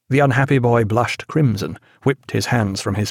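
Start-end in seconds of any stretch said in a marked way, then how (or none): none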